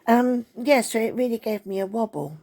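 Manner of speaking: normal talking voice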